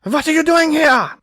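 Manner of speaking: put-on high, loud barking German accent; barked German accent. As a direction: bark it out with a thick German accent. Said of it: "She had a densely thick Germanian accent".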